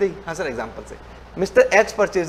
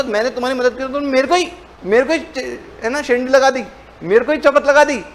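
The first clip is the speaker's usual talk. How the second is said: mock indignant complaint; 6 semitones higher than usual. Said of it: "तो मेरे को बड़ा गुस्सा आ गया".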